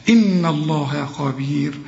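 إن الله خبير slow, with a light nun and a full kha; slow correct recitation. Shown as a demonstration. Teacher reciting the correct version: slow and careful. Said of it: "الخاء في القرآن".

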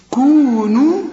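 كونوا chanted, the long vowels held equally long; recited with even madd. Shown as a demonstration. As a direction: hold the long vowels of كونوا to the same length. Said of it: "المدود قد بعض".

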